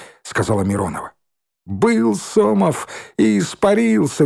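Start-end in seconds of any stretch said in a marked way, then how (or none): 1.6-4.2 very high, slow lilt; drawn-out, singsong teasing; gleeful mockery. A narrator's reading. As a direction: make merry over the banishment. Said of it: "веселилась Шмакова".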